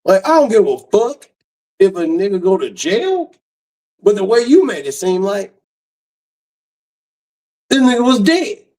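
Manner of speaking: exasperated rant. Real, not acted. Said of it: "venting anger at sister".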